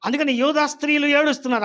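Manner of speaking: fast fervent preaching. Acted.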